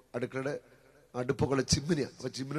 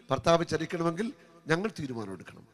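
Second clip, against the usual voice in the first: usual speaking voice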